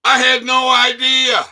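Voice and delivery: loud, high sarcastic exclamation; mock astonishment. Real, not acted. Reacting to the dentist's retirement letter with loud mock shock. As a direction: fake shock, thrown out to the viewers.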